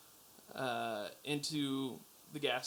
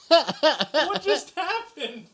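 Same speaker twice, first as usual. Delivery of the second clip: high, loud, slowed-down laughing what; stunned and cracking up at once; laughing disbelief; 11 semitones higher, 9 dB louder, much slower than usual. Retold or imitated. Retold reaction to stabbing story.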